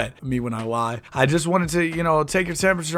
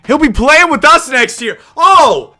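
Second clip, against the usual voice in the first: much higher and louder, slow gloating exclamation; excited outburst; 10 semitones higher, 11 dB louder, slower than usual. Acted. GM boasting to rival Danny about signing Hassan, loud and excited. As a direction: crow it out as big news. Rival GM's brag, streamer reacts.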